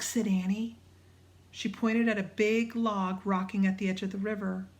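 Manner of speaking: ordinary delivery, nothing marked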